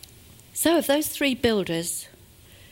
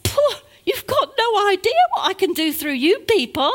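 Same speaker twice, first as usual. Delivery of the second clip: mock dismissive God; 10 semitones higher, 5 dB louder than usual. God voiced dismissing human plans.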